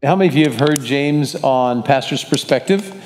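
ordinary talk, nothing marked

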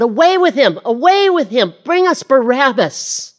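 high, loud jeering crowd chant; bay for his death like an angry mob; crowd shouting. Acted. Preacher voicing the crowd at the crucifixion, shouting.

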